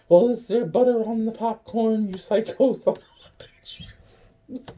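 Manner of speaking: high mocking voice. Vlogger mocking an imagined critic, high then whispered. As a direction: sneer it high, then hiss the insult. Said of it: "you know".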